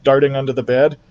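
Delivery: plain reading voice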